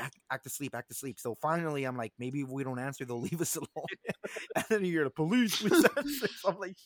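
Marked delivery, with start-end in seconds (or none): 0.0-1.2 hushed. Retold or imitated. Whispering to friends to hide. 2.2-3.9 hushed. Retold or imitated. Whispering hoping police leave. 5.6-6.3 police voice. Retold or imitated. Imitating police at door.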